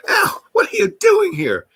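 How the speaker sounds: high, startled cry of surprise; startled yelp. Retold or imitated. Startled man hit by door.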